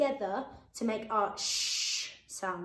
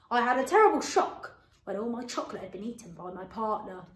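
usual speaking voice

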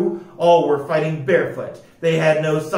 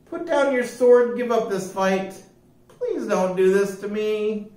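higher pleading voice; begging; 6 semitones higher than usual. A narrator's reading. Joe begging his sister to stop, pleading. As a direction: beg for mercy.

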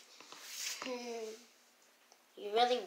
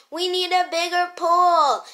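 loud, raised complaint, short of a full yell; toned-down yell; 5 semitones higher, 10 dB louder than usual. Acted. Yeller saying sorry, repeating it in a toned-down yell. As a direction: still loud, but rein the yell in. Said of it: "Stop yelling!".

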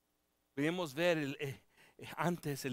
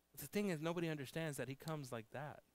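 ordinary talk, nothing marked

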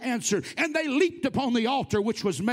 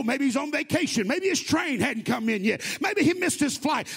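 mocking taunt. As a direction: taunt them relentlessly as the prophet sick of their idol. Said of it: "He is mocking it".

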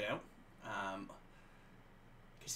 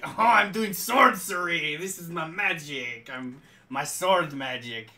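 slow, loud, dopey mage voice; goofy self-important sorcerer; dim sword mage; 3 semitones higher, 9 dB louder than usual. Retold or imitated. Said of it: "a character that speaks like that".

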